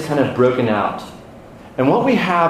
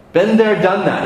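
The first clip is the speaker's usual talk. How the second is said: put-on British accent; 7 semitones higher than usual. Pastor answering the congregation in a British accent. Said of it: "Did you say that with a British accent?".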